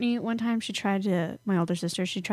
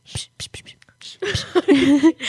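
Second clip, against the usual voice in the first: teasing psh; 6 semitones higher, 6 dB louder, much slower than usual. Pretend.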